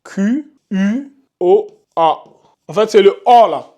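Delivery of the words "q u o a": "Q U O A" spelled out very slowly. Shown as a demonstration. Teacher dictates spelling to students, very slowly. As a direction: spell each letter out slowly. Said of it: "Comment moi j'écris ça".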